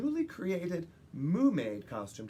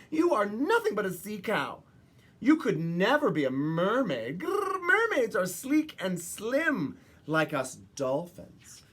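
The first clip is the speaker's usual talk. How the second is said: louder, higher mocking sneer; scoffing dolphin; 5 semitones higher, 4 dB louder than usual. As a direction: sneer down at the sea cow. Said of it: "one scoffed".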